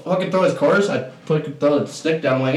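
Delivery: ordinary talk, nothing marked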